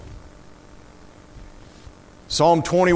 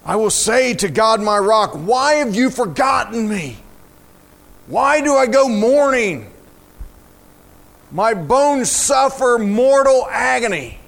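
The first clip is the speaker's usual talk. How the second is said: high, impassioned lament, key words stretched; dramatic psalm reading; 6 semitones higher, 4 dB louder than usual. Acted. Preacher reading a downcast soul questioning God, impassioned lament. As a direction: plead the questions out with full weight.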